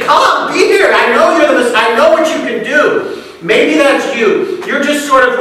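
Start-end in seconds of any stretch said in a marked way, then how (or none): 0.0-3.2 higher, brighter, pleading call to Jesus; eager invitation. Pretend. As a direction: call him in, excited and sure. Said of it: "She would have said".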